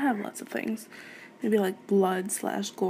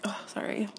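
whispered sorry; 7 dB quieter than usual. Real, not acted. Embarrassed apology after giggling.

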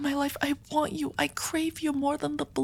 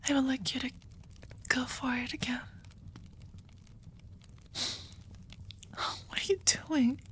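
hushed, breathy confession to a lover; soft ASMR whisper; intimate whisper; 7 dB quieter than usual. Acted. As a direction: whisper it close to the ear, afraid to lose them. Whispered kiss scene, ASMR.

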